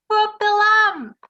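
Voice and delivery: loud, slow call to rub the ring; shouted. A narrator's reading. Audience shouting advice to Aladdin, loud and slow. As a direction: yell it out like a panto crowd. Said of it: "They shouted".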